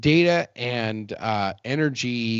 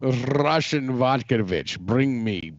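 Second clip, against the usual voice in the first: mock Russian accent; slower than usual. Podcast host jokes he is a Russian bot, hammy Russian accent. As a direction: play a Russian bot ordering vodka. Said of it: "in a Russian accent".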